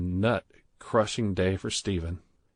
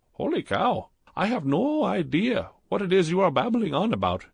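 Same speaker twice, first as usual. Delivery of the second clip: put-on higher, louder, heavy Indian accent; thick Indian accent; 10 semitones higher, 3 dB louder than usual. A narrator's reading. Clerk Badrul flirting with Stephen in the jacuzzi, thick Indian accent.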